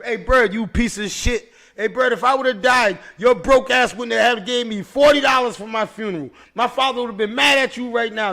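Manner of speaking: retold yelling at a cellmate; mock angry tirade. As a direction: chew him out, half joking. Cursing out Bird in retold scene.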